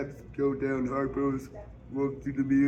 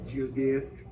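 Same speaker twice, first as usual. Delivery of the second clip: plain reading voice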